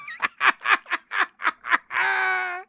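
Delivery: high burst of laughter at own joke; big shrieking laugh. Real, not acted. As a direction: crack up at the pun.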